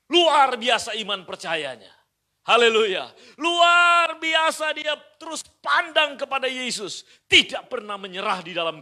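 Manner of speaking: loud, high, slow shouted praise; preaching at full force. Acted. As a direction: lift the congregation with a shout. Preaching climax.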